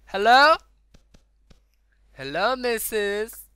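high, loud, incredulous hail; calling out. Pretend. Gamer hailing woman walking into wall, loud calling. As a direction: call loudly as if to someone oblivious.

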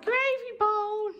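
very high, slow sing-song offer; dog-talk voice. Real, not acted. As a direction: coo the treat name up high.

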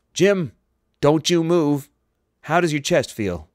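high, loud, insistent call; urgent alarm. A narrator's reading. Doctor to injured captain over communicator, urgent and loud. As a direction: order the patient to stay still.

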